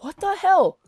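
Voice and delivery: high-pitched retold outburst; indignant shock. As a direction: burst out high in mock disbelief. Retold shock at being accused.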